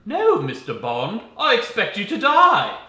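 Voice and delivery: hammed-up English villain accent; mock British villain. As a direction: sneer it like a Bond villain. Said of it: "I have another version".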